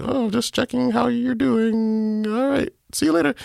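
high, slow, airy put-on voice for the friend; friend's casual voice. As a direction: float it up high like a breezy check-in. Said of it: "And he was just like".

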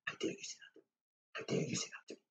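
acting out a furious row in a whisper; whispered mock rage read aloud to oneself. Shown as a demonstration. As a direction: hiss the angry lines under your breath. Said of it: "in a kind of whispered voice".

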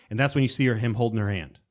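ordinary talk

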